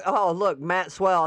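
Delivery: ordinary delivery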